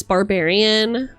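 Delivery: normal talking voice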